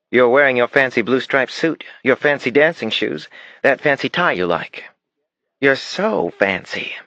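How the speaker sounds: put-on high child's voice, playfully teasing; teasing little girl. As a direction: daughter teasing Daddy about his fancy suit in a high child voice. Narrator voices the daughter.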